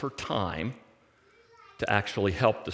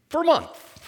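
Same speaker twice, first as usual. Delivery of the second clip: greedy glee; 4 dB louder than usual. Retold or imitated. Laban imagines greedy gain.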